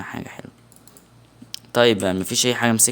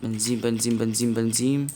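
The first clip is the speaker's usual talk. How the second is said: muttered search; 3 semitones lower, 6 dB quieter than usual. Real, not acted.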